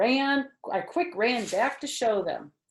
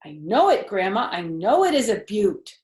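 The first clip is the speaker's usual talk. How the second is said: put-on Junie B's proud, loud little-girl voice; 2 semitones higher, 8 dB louder than usual. A narrator's reading. Junie B answers Grandma, proudly showing off her tooth. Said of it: "I said real proud".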